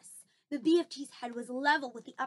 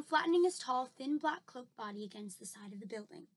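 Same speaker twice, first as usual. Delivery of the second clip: ordinary delivery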